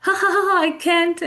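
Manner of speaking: high, giggly demonstrated laughter; laughing. Shown as a demonstration. Teacher shows real amusement, laughing. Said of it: "I'm laughing and I'm goggling".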